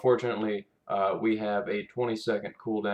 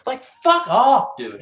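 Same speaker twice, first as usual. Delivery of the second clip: much higher and louder, slow; furious outburst; 12 semitones higher, 10 dB louder, slower than usual. Real, not acted. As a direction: bursts out at the designers, fed up. Exploding at the designers' pretension.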